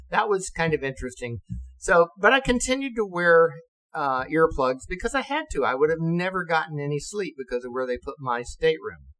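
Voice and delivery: usual speaking voice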